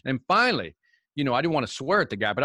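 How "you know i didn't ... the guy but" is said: ordinary talk, nothing marked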